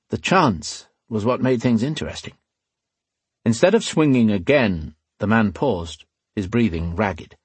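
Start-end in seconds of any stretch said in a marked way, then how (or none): none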